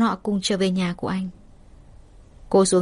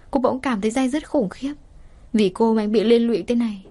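usual speaking voice

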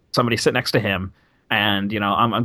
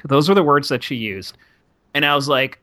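normal talking voice

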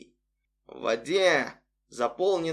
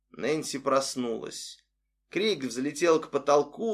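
ordinary delivery

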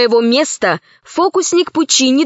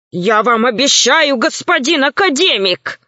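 ordinary delivery

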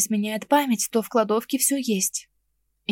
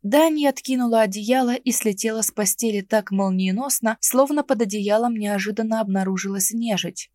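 usual speaking voice

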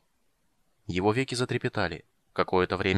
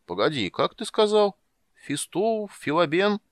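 a hint of a higher, puzzled old man; lightly voiced old man; 9 semitones higher, 3 dB louder, slower than usual. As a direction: ask again like a muddled old man. Old man asks name again. (слегка изображённый рассеянный старик)

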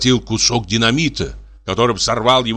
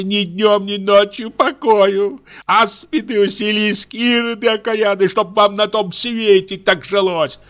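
put-on wailing old maid; 11 semitones higher than usual. A narrator's reading. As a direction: wail and curse like a martyr. Dashenka laments and rages.

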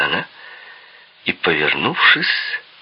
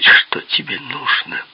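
whispery mimicry of a hissing voice; mock hissing imitation; slower than usual. Visitor mimics Peppi's question back, hissing. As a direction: quote him back in a mocking hiss. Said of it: "шипящий голос".

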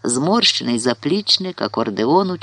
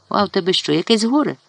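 quick, higher-pitched query; quick question; 6 semitones higher, faster than usual. A narrator's reading. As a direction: ask promptly, pitch lifting. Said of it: "і швидко спитала". (швидке запитання)

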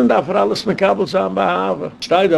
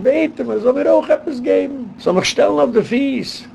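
high-pitched, imploring appeal; acted-out plea, pitched high; pleading; 9 semitones higher than usual. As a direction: plead for help in a raised voice. Acting out Naomi's planned plea for help.

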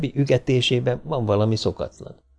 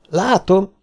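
high, louder cry of sighting; exclaiming; 9 semitones higher, 4 dB louder than usual. Acted. Donovan calls out on spotting the robot, loud. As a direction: call out the sighting. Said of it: "kiáltott fel".

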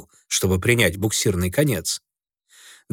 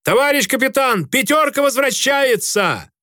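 shouting; 13 semitones higher than usual. A narrator's reading. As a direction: yell the news through the door. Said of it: "вестовые закричали в открытую дверь".

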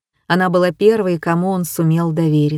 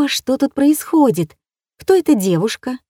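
put-on excited young girl; 6 semitones higher than usual. A narrator's reading. Fawn curious.

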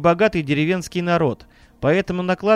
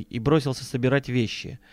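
usual speaking voice

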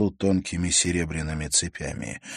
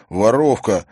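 indignant cry; 4 semitones higher, 8 dB louder than usual. A narrator's reading. Timofey accuses indignantly.

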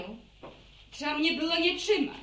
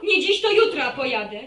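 loud, lower, stubborn vow; defiant outburst; 3 semitones lower, 6 dB louder than usual. Acted. Daughter defies her mother, loud and stubborn. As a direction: dig in and refuse.

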